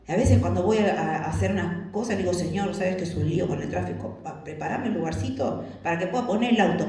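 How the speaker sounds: usual speaking voice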